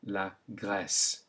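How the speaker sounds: slow la Grèce with open è; la Grèce slowly; French è. Shown as a demonstration. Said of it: "Try it again".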